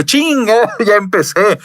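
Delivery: high-pitched exclamation with a laugh; retold squeal of self-reproach; mock dismay. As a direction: curse your own slip, high and laughing. Retold dismay at own slip.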